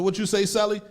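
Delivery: high, loud startled call across the cell. Retold or imitated.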